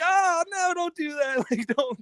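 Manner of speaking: high squeaky laughing protest; mock alarm. Host reacting to the chat joke, high laughing protest. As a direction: shriek a joking no. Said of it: "And I was like".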